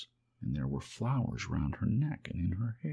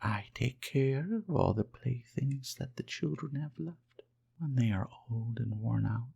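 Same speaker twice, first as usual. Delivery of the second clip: gentle fairy voice; 6 semitones higher than usual. Acted. Fairy comforting the lonely toy rabbit, high and gentle. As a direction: speak as a kind fairy, lifted high. Fairy explains her task.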